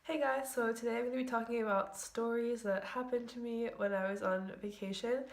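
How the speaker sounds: usual speaking voice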